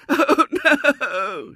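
high, loud, drawn wail; wailing little girl. A narrator's reading. Girl dismayed at William's simper, loud wail. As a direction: howl it in broken pieces. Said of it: "she wailed".

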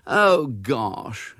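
put-on put-out older sister; exasperated groan. A narrator's reading. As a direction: groan at an unwelcome arrival. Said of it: "Ethel groaned as William entered".

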